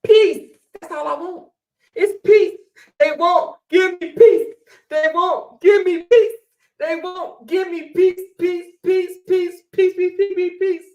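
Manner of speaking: high, loud begging for peace; hammed-up desperate peace shout; mock shouted plea. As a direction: cry out for peace.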